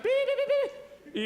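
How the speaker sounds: high, laughing imitated beeps; watch alarm beeps. His watch signals eleven, imitated beeping. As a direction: mimic a watch alarm going off. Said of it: "My watch then said".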